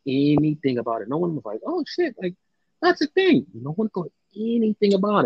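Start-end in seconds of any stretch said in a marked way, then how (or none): 1.6-3.5 mock realization. Mocks fans who missed hint.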